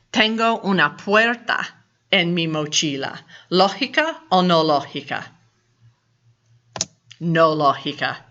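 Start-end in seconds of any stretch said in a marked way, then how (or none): none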